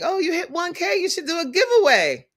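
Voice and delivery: high, sing-song imitation of followers; lightly mocking what everyone says; mock chat voice. Coach mimics followers urging a giveaway, high and teasing. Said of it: "everyone talks about doing a giveaway".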